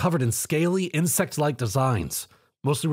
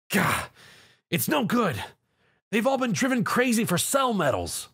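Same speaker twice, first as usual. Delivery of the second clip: frustrated; 8 semitones higher than usual. Acted. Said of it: "Zio realized".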